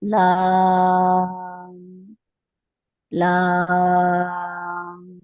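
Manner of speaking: लाम with the आ held for six counts; six-count elongation. Shown as a demonstration. Teacher demonstrating the long madd on लाम. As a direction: stretch लाम very slowly, recited. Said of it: "ला को six count खींचेंगे".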